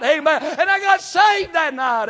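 shouting, high and loud; preaching at full force. Acted. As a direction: preach it out at the top of your voice. Preaching climax, shouting testimony.

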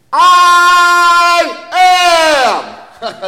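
high, loud, held-out proclamation; climactic shout. Acted. Preacher crying out to the church, high and loud. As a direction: ring out the words I am.

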